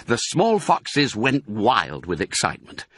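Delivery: ordinary delivery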